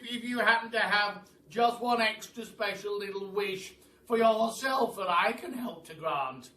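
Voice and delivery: put-on loud, high, halting old gentleman; the Duke stumbling kindly over his offer; kindly stammering Duke. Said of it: "said the Duke in his kindly, stammering voice".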